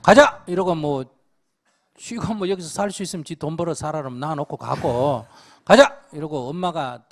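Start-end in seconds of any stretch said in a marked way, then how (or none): 0.0-0.4 sudden loud high call; acted shout. Mother telling the family to move, loud high shout. 5.7-5.9 sudden loud high call; acted shout. Mother telling the family to move, loud high shout.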